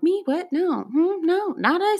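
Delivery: high, sing-song innocent denial; fake innocent denial. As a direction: play dumb and lie badly. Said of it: "clearly lying through my fucking teeth".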